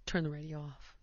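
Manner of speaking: quiet, low warning; hushed order. Retold or imitated. Mom telling her daughter to cut the radio, hushed and serious. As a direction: say it low like a secret is coming.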